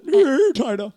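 loud, high whinnied words; high, forceful talking-horse cry; demanding horse. Acted. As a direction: neigh it out as an order. Horse demands tighter knot.

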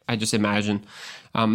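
usual speaking voice

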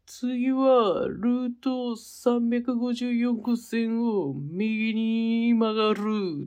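very slow, drawn-out cartoon voice; Anago-san impression. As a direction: read the sign like Anago. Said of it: "アナゴさんで。".

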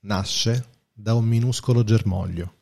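calm solemn reading voice; quote reading. Acted. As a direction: read it like a poem. Reads a Lao Tse quote.

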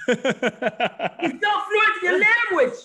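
slow, high, laughing through the words; laughing repeat. Real, not acted. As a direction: laugh as you say it again. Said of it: "repeats his point laughing".

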